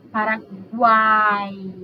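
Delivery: Paraguai very slowly, every sound drawn out; slow demonstration. Shown as a demonstration. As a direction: stretch Paraguai so each sound is heard. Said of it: "O som mais forte desse tritongo é o A".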